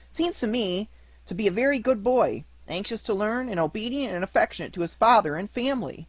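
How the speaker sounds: high, sweetly earnest puppet voice; puppet fibbing. A narrator's reading. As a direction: vouch for yourself in a high puppet voice.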